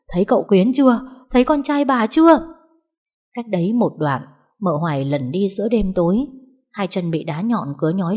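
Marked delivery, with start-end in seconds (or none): 0.1-2.4 high, loud, urgent questioning; pressing questions. Acted. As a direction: demand an answer, pushing harder each time. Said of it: "hỏi dồn". (hỏi dồn dập, cao và to)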